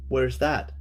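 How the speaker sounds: ordinary talk, nothing marked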